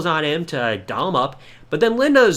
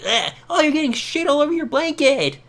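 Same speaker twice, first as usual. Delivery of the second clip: high, disgusted mock squeal; a hammed-up grossed-out teen; 9 semitones higher than usual. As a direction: act revolted at a dirty diaper. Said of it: "She's getting grossed out".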